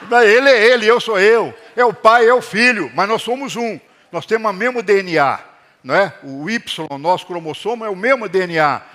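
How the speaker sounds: ordinary talk, nothing marked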